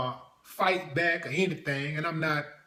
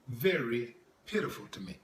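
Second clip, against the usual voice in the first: normal talking voice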